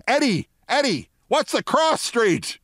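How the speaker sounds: high, hollering call; insistent calling out. Retold or imitated. Steinbrenner asking Eddie for the cross street, high holler. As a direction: pitch it way up and draw out the question.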